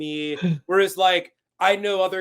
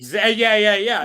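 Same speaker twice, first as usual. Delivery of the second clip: laughing while agreeing, louder; laughing yeah; 3 semitones higher, 7 dB louder than usual. Real, not acted. Agreeing with his friend's joke, laughing loudly. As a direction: let the agreement spill into laughter.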